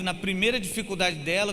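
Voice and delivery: ordinary talk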